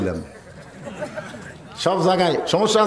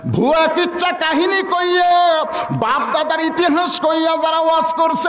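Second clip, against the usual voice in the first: high, chanted imitation of a lament; retold tearful plea; 11 semitones higher than usual. Preacher voicing the jailed cleric's tearful complaint, high chanted lament. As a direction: stretch it into an impassioned, singsong complaint. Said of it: "আমির হামজা চোখের পানি নিয়ে বলতেছে".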